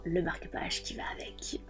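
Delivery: whispered aside. Real, not acted. Showing the bookmark to viewers, whispered. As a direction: drop to a secret whisper.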